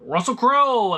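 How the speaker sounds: finally remembering the actor he forgot; eureka recall. Real, not acted. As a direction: burst out with the name once it comes.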